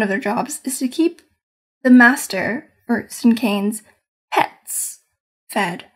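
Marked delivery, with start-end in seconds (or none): none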